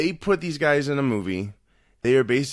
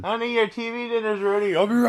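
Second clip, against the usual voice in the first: high-pitched mom calling out; 8 semitones higher than usual. Pretend. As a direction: play the nagging mother. Plays mom calling son.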